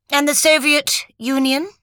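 high-pitched, cool character voice; cool question. A narrator's reading. Cecile asks Lucy over her cards, coolly. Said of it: "asked Cecile coolly".

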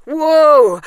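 loud, high yelp of alarm; startled cry. A narrator's reading. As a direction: cry out, jumping back from danger. Startled by robot with scissors.

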